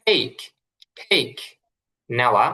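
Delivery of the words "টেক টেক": "টেক" with a puffed English t; correct TAKE. Shown as a demonstration. Teacher models take for learners, "টেক" with aspirated t. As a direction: say "টেক" as "theik", crisp final k. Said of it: "এটা টেক".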